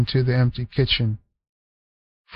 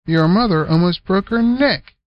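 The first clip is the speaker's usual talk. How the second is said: chiding; 6 semitones higher than usual. Acted. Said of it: "chided Selma".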